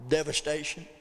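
ordinary talk, nothing marked

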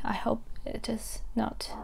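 hushed, uneasy aside; quiet, real worry breaking into the lesson; worried hush. Real, not acted. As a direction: drop to a worried hush as if listening for blasts. Worried about explosion noises nearby.

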